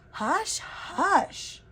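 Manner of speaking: high, hushing the noisy ghosts; shushing. A narrator's reading. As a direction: settle them without looking up. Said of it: "Benita shushed".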